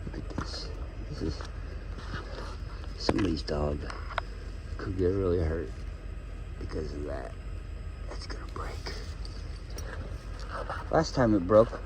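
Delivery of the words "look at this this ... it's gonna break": softer muttering; hushed. Real, not acted. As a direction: quietly point out the frayed leash. Whispers about leash.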